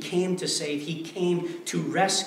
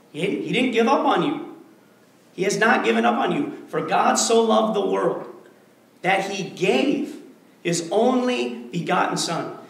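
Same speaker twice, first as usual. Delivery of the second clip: louder, driving pulpit declaration; full-force preaching; 3 semitones higher, 7 dB louder than usual. Acted. Pastor hammering the point home to his congregation, forceful preaching.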